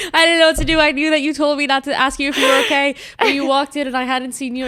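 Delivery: high, loud, laughing blurt; flustered friend. Retold or imitated.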